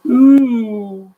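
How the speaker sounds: high, loud wail; a frightened drawn-out wail. A narrator's reading. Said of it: "wailed Mister Dizzy".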